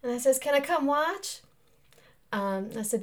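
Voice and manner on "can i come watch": high, slow Mississippi question; Mississippi accent. Acted. Wife asking to tag along, Mississippi accent. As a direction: ask it high in a Mississippi drawl. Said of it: "Mississippi accents".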